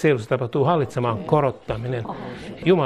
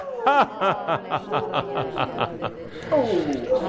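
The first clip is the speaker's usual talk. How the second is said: long falling laugh; preacher's laugh; 3 semitones higher than usual. Real, not acted. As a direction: laugh out in release after a forceful prayer. Laughs out after the command.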